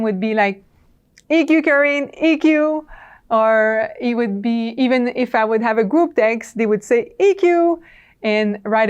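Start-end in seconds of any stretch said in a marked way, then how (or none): 1.3-2.9 higher-pitched imitation of teammates calling. Team reminds frustrated colleague at the office, calling out. 7.2-7.8 high, louder imitated team reminder; calling out. Team reminds colleague in group text, calling out.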